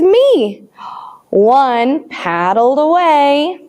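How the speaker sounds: put-on sing-song counting rhyme for children. Teacher chants a rhyme for the children, sing-song. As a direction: count along brightly with the class.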